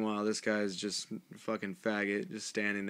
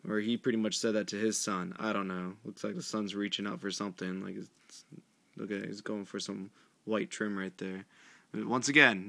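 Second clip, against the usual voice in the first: ordinary delivery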